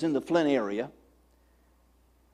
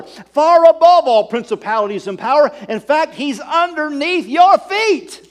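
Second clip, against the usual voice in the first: loud, higher, fervent preacher voice; preaching at full force; 5 semitones higher, 7 dB louder than usual. Acted. Pastor driving home redemption to the congregation, full-force preaching. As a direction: preach it out to the whole church.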